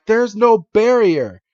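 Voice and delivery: mock exclamation. Podcaster voicing Iris in an episode recap, mock exclamation. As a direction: play an alarmed cartoon line, stretched out. Acted quote in anime retelling.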